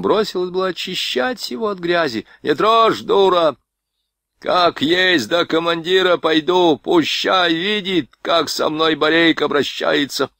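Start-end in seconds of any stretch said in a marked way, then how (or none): 2.4-10.3 higher, slow barking orders to his wife; the beaten sergeant ordering his wife about; angry orders. A narrator's reading. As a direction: bark at the wife in wounded rage. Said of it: "приказал жене".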